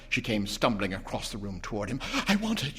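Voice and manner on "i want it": plain reading voice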